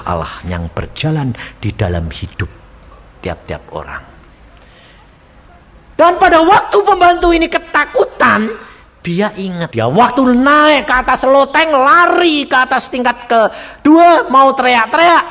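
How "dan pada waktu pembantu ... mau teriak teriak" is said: high, loud dramatic sermon storytelling; full-force preacher narrating a frantic escape; urgent preaching. A narrator's reading. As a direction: raise the voice to a panicked pitch as the story climaxes.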